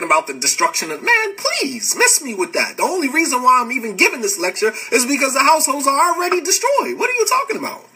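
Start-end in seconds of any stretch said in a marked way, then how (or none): 1.0-7.0 high-pitched, incredulous scoffing; exasperated, pitch shooting up in disbelief; exasperated dismissal. Real, not acted. Scoffing at a critic's objection. 7.0-7.9 very high-pitched, incredulous retort; exasperated squeak of a question; exasperated disbelief. Real, not acted. Same retort, repeated in exasperation.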